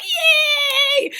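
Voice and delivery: high, screaming, stretched cheer; mock ecstatic cheer. Women cheering the pool party, mock ecstatic. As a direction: squeal it loud and long.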